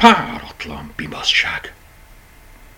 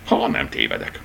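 plain reading voice

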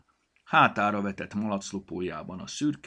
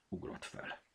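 usual speaking voice

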